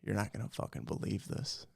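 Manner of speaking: low, unvoiced hush; hushed dad. Dad on the phone to his son, whispering. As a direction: lean in and whisper it like big news. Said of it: "My dad calls me and he goes".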